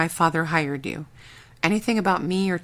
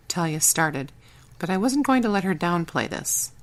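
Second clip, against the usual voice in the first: usual speaking voice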